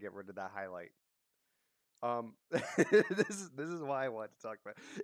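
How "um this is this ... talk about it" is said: laughing in disbelief at what was just heard. Real, not acted. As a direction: break into a laugh while talking. Laughs at the clip just played.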